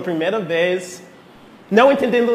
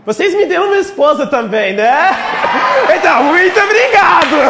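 loud, laughing thanks; excited shout; 9 semitones higher, 6 dB louder than usual. Real, not acted. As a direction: burst out with joyful thanks.

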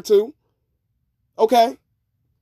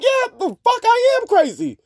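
a higher-pitched mock defiant comeback; mock defiant woman; 10 semitones higher than usual. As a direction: play a woman owning her craziness, much higher. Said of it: "Just sit there and be like".

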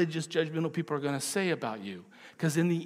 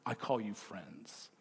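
soft, slow and low; tender; 6 semitones lower, 9 dB quieter, slower than usual. Retold or imitated. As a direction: say it gently to those who will fail you. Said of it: "looks at them and says".

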